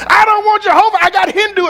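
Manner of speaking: high, pushy mock voice of a young doubter; mock young person. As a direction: play a stubborn youth demanding his own pick. Mocking a young person's choice.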